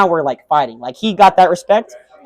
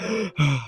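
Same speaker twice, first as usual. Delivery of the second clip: mock out-of-breath gasps; breathy acted panting; imitated gassed panting; 3 semitones lower, 10 dB quieter than usual. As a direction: puff like a spent boxer. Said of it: "I'm kinda gassed, I'm like".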